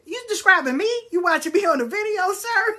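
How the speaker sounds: hammed-up high-pitched suspicion; incredulous challenge. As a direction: catch the reader out in squeaky disbelief.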